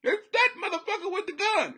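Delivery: high, loud, comic panic; high-pitched mock alarm; talking deer. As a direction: squeal it as a terrified talking deer. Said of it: "but with his mouth open".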